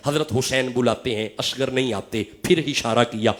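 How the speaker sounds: usual speaking voice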